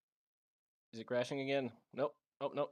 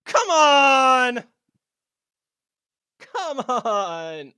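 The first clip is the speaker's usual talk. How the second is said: loud anguished yell; frustrated shout; 7 semitones higher, 14 dB louder, much slower than usual. Real, not acted. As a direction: cry out in frustration. Game freezes.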